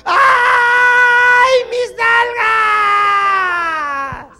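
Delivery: very high, very loud, stretched scream; frog's shriek. Acted. Frog realizing its rear end was cut off, long high scream.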